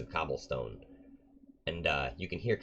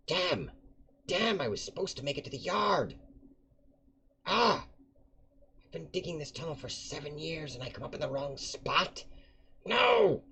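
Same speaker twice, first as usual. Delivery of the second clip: put-on high, wailing old man cursing his luck; frustrated old digger; 12 semitones higher than usual. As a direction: rage at the wrong hole in a high old voice. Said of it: "And he says".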